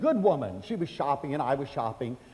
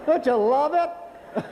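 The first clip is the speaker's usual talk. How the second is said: slow, high, laughing aside; 9 semitones higher, slower than usual. Real, not acted. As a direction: ask it through laughter. Laughing at her complaint.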